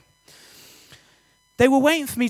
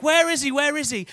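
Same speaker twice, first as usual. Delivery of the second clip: retold scream; 6 semitones higher than usual. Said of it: "She's screaming".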